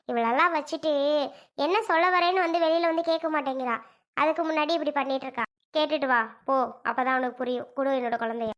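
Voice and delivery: usual speaking voice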